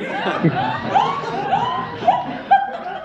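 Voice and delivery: high rising giggle. Real, not acted. Narrator giggling at the baronet's greed. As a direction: let a squeaky giggle escape.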